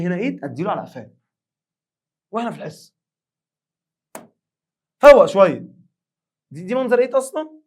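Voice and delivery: loud, high teasing scold. Real, not acted. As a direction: call the class to attention loudly. Teacher scolding class loudly. (زعيق مرح عالي على الطلبة)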